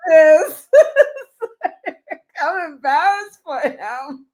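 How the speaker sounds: high, laughing through the words; helpless laughter. Real, not acted. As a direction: crack up in secondhand embarrassment. Cracking up with embarrassment for him.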